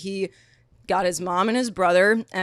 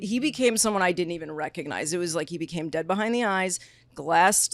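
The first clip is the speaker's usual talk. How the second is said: ordinary talk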